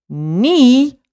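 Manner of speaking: knee said nee, no K; silent k. Shown as a demonstration. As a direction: drop the K in knee. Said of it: "like your knee".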